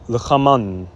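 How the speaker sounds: 'lachaman' with throaty ch, closing on n; Hebrew feminine form. Shown as a demonstration. Teacher demonstrates feminine 'their food', careful 'lachaman' ending on n. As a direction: say 'lachaman', final vowel before n. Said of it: "if it was feminine".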